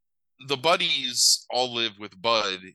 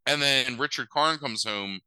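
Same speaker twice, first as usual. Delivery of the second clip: ordinary talk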